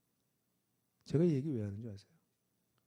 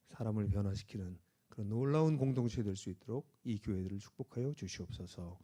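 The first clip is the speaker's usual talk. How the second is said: earnest pastoral prayer, lower voice; closing prayer; 4 semitones lower, 4 dB louder than usual. Acted. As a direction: pray over the congregation, steady and earnest.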